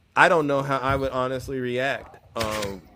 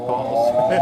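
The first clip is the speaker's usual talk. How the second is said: comic callout; 2 semitones lower, 6 dB louder than usual. Real, not acted.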